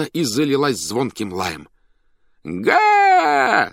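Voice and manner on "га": drawn-out, very high clown whoop; shouting. A narrator's reading. Master whoops to the circus audience, shouting. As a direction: let out one long loud high cry. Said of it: "закричал хозяин".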